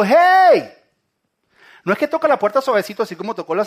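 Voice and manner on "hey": high, full-volume shout to someone inside; grito de llamada. Acted. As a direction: call out loudly from outside the door. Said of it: "sino gritaba. Jesús está de afuera diciendo". (grito fuerte llamando desde afuera)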